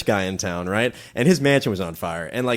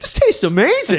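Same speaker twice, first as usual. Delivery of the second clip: put-on kids in an ad; 4 dB louder than usual. Kids in cereal ad voiced.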